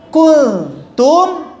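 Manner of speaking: Kuntum with the tongue held away from the ta; correct kuntum. Shown as a demonstration. Teacher modelling the correct Kuntum. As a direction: say Kuntum with the nun hidden. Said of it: "harus agak jauh lidahnya".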